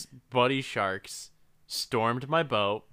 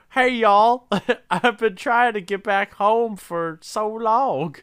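much higher and louder, laughing through it; silly high voice; 10 semitones higher, 7 dB louder, slower than usual. Pretend. Man stuck in the shark calls out, high and giggly. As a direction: squeak like a lost little man inside a shark.

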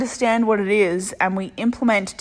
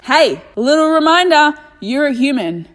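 playful callout; 5 semitones higher, 3 dB louder than usual. Real, not acted. Playful aside to listener.

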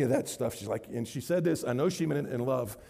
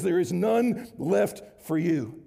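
each word laid down slowly and deliberately; slow and emphatic; 3 semitones higher, 3 dB louder, much slower than usual. Acted. Preacher assuring students that no condemnation remains, slowly. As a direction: let it land, word by word.

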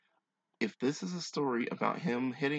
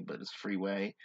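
ordinary talk, nothing marked

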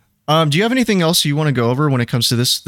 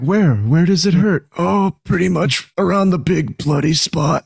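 hammed-up film quote, higher voice; put-on comic voice, pitched up; quoting a movie character; 6 semitones higher than usual. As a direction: replay the silly movie exchange in character. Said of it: "He's like".

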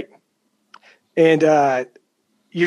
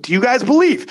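hype call; 7 semitones higher than usual. Retold or imitated. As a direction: pump the crowd up on the repeat. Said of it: "So you hype 'em up".

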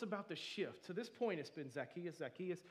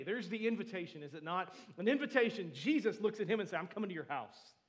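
loud, raised preacher voice; fervent preaching; 5 semitones higher, 12 dB louder than usual. Real, not acted. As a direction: preach it out with force, pitch lifted. Preaches at full force.

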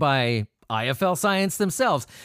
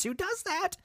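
mock squeaky aside; 8 dB quieter than usual. Mock aside about patreon perks.

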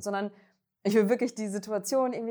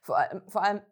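swallowed wrong. Real, not acted. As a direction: voice catching after choking on a drink. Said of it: "sorry, verschluckt". (verschluckt, mit belegter Stimme)

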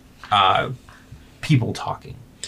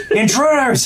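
very high, loud shout; high-pitched acted yell; woman's alarm cry; 5 dB louder than usual. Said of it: "And the woman goes".

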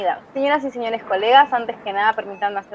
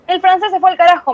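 frustrated outburst; 6 semitones higher, 4 dB louder than usual. Acted.

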